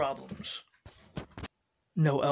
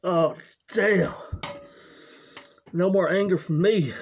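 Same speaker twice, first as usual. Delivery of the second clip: loud relieved addict; 5 dB louder than usual. Acted. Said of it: "the drug and alcohol addict".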